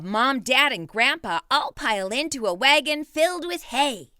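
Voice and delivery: usual speaking voice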